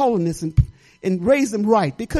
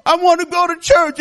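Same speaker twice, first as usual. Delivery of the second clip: louder, higher insistent plea; pleading to God; 6 semitones higher, 5 dB louder than usual. Retold or imitated. Mother retelling her plea to God to return to church, louder insistent plea. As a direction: demand it of the Lord. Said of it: "I'm saying".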